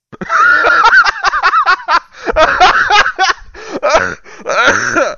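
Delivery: loud helpless laughter; burst of laughter. Real, not acted. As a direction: crack up loudly. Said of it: "Stop making me laugh".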